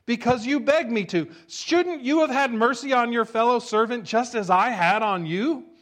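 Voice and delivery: king thundering in anger at his servant; angry king. Acted. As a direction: roar it at the wicked servant. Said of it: "In anger".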